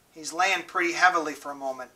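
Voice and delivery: usual speaking voice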